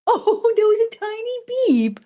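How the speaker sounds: very high, squeaky coo over something tiny; delighted cooing. Real, not acted. Owner reacting to a tiny beep, high-pitched cooing.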